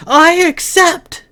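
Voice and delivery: high, loud, slow triumphant scream; Lily screaming her acceptance to the sky. A narrator's reading. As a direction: scream it so distant listeners hear. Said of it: "She screamed it".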